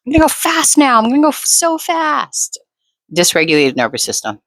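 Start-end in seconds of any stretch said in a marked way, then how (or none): 0.1-2.6 much higher, hammed-up panicky driver; mock a reactive driver, voice pitched way up; mock road-rage driver. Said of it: "some people be like".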